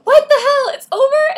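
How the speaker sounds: high, loud shocked shriek; screaming reader disbelief; shocked outrage. Retold or imitated. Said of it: "and you're like".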